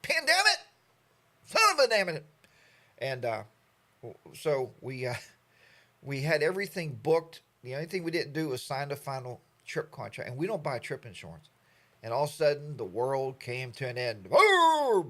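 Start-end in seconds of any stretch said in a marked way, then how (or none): 0.0-2.2 much higher, louder exasperated cry; mock outburst. Cursing the pandemic wrecking his trip, loud mock exclamation. 14.3-15.1 much higher, louder booming exclamation; dramatic boom. Pretend. Describing the world shutting down, a loud dramatic boom.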